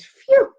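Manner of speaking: a loud, high exhale of Phew!; tired phew. Acted. Tired mom at the restaurant blowing out a loud phew. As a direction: puff out Phew! like you're worn out. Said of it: "Mom starts to look tired and says".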